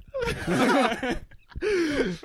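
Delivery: high burst of laughter; real laugh breaking out. Real, not acted. Host laughs welcoming Matt, loud laughing.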